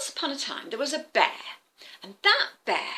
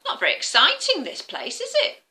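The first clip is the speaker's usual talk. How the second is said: higher, mildly disappointed bear; let-down bear; 9 semitones higher, 4 dB louder than usual. Acted. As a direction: shrug it off, then suggest the picnic.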